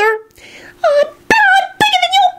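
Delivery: tiny, very high slow screech. A narrator's reading. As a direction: screech the boast in a piping voice. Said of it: "Arthur screeched as he jumped up".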